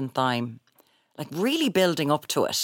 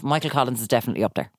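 normal talking voice

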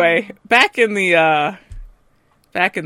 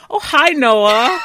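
mock cheery greeting; 7 semitones higher, 7 dB louder, slower than usual. Character greets Noah in the recap.

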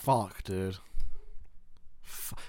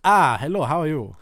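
hammy Italian diner greeting, higher and louder; Italian accent; 3 semitones higher, 9 dB louder than usual. Pretend. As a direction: put on a big Italian accent. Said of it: "I will".

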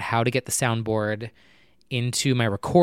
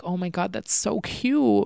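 gushing coo; 7 semitones higher than usual. Retold or imitated. Recalling her reaction to the crab cartoon, cooing high. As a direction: coo it as if melting at a cute cartoon.

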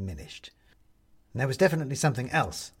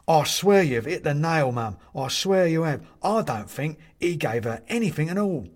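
shocked; 5 semitones higher, 5 dB louder than usual. A narrator's reading. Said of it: "with a shocked expression".

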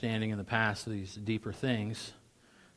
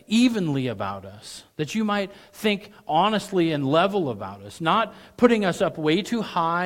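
preaching; 6 semitones higher, 7 dB louder than usual. Acted. Preaching climbs.

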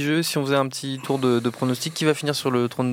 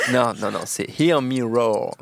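usual speaking voice

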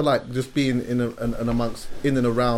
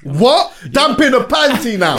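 excited; 9 semitones higher, 6 dB louder, slower than usual. Real, not acted. Friend reacts to chef line.

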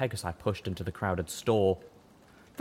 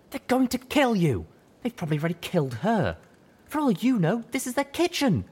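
high, frantic, scolding buck; panicked high young stallion; 14 semitones higher, 4 dB louder than usual. Acted. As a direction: panic and scold your partner.

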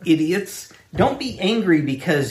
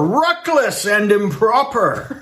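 slow, higher, hammed-up corporate indignation; mock gusto; 6 semitones higher, 3 dB louder, slower than usual. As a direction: lay on the outrage with gusto. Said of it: "they said it with that gusto".

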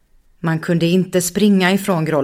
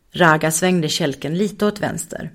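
ordinary delivery, nothing marked

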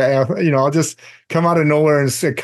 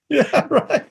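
laughing; 10 semitones higher than usual. Real, not acted. Laughing reply.

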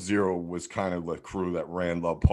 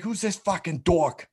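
high-pitched imitation of an aggressive Jersey guy screaming; mock Jersey yell; 11 semitones higher than usual. Angry skater yelling at the kid who snaked him, mock Jersey scream. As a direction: put on a thick Jersey accent, pitched way up. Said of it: "I hear him start screaming".

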